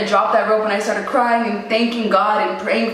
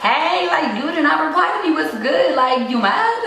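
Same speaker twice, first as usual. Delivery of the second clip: teasing nudge; 7 semitones higher than usual. Retold or imitated. Teasing text to silent friend.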